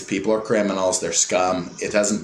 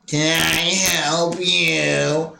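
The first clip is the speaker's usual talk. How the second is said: higher, very slow, sarcastic; mock dismissive clerk; 5 semitones higher, much slower than usual. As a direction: stretch it out as a cold brush-off.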